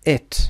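"it" with a short, lax vowel. Shown as a demonstration. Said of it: "این صداها کوتاه هستن". («it» با صدای کوتاه)

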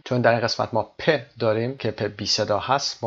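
normal talking voice

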